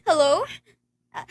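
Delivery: ordinary talk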